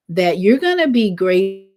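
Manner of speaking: ordinary talk, nothing marked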